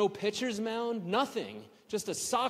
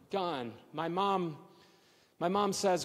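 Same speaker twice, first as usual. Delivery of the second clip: usual speaking voice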